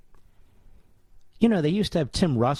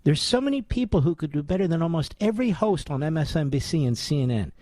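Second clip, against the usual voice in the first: ordinary talk, nothing marked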